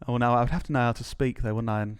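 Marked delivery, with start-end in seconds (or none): none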